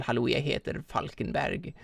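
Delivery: Norwegian in a posh Oslo voice; posh Oslo accent. Acted. Mimicking posh Oslo people introducing themselves, posh Oslo accent. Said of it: "like, posh Oslo people".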